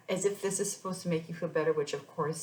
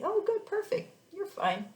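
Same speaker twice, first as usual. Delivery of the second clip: mocking breezy doctors; 4 dB louder than usual. Mocking doctors brushing her off.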